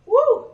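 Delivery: loud, very high whoop to break the tears; real release after near crying; shaking off tears whoop. Real, not acted. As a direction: blow out the emotion with a shout. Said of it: "I'm not crying on camera".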